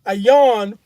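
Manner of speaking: normal talking voice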